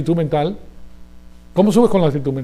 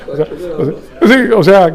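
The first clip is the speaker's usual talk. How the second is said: laughing; 7 dB louder than usual. Real, not acted. Laughs at his own joke.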